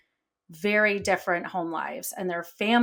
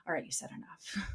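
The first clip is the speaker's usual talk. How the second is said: whisper to self; 2 semitones lower, 14 dB quieter than usual. Retold or imitated. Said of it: "bite my tongue and be like".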